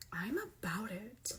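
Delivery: slow unvoiced whisper. Real, not acted. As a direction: whisper it to yourself. Quiet aside after tasting.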